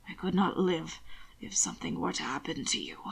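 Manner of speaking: whispered, desperate confession; hushed plea kneeling before him. A narrator's reading. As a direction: breathe it out in a devoted whisper. Francis confessing, voice dropped low.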